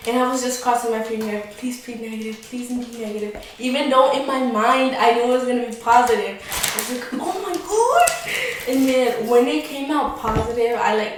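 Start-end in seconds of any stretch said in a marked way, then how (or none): 1.6-3.4 softly pleading under the voice; a quiet little wish, said twice; lightly acted, soft begging; hushed plea. Crossing fingers, silently pleading. 7.1-8.1 mock-shocked, pitched way up; retold shock. Retold shock at test result.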